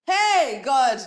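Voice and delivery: loud, high-pitched exclamation; mock shock. Real, not acted. Co-host reacting to a cheeky first question, mock shock. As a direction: cry out to heaven, loud and high.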